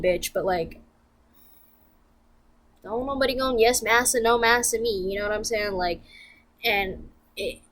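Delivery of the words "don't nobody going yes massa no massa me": louder, higher defiant declaration; defiant refusal to be submissive. Real, not acted. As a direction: push back hard, sassy and firm. Emphatic defiant retort.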